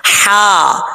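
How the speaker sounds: हा as a long breathy throat sound; the letter हा sounded alone; isolated Arabic ha. Shown as a demonstration. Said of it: "ये आवाज़ आनी चाहिए".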